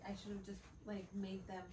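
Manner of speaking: ordinary talk, nothing marked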